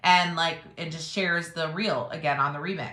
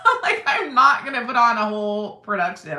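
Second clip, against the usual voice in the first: laughing; 4 semitones higher, 8 dB louder than usual. Real, not acted. Laughing at her own lazy approach.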